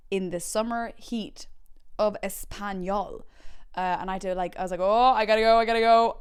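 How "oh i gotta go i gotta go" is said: loud, insistent mock panic; urgent outcry. Kid power walking to caravan, loud urgent cry. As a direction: cry it out, desperate to get away. Retold urgency while fleeing.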